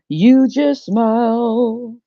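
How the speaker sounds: very slow, drawn-out command; slow insistence. Acted. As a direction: stretch each word out. God insisting slowly.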